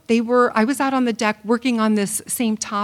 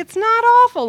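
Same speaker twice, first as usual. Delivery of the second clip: exasperated retort; 11 semitones higher, 6 dB louder than usual. Retold or imitated. Husband's retort in retold argument.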